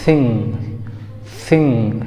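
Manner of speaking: थिंग opening on an airy th, not a stop; native th sound. Shown as a demonstration. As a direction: say थिंग with tongue between teeth, air flowing out. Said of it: "और क्या पढ़ा जाएगा?".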